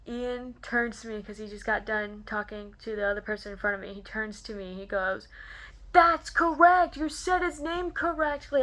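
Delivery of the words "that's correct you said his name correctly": emphatic approval. Retold or imitated. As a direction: imitate a big theatrical announcement. Voice actor's emphatic approval imitated.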